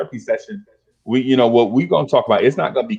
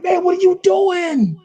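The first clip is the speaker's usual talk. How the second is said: much higher, slow, aghast; slower than usual. Retold or imitated. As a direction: stretch out the disbelief. Friend throws tobacco away.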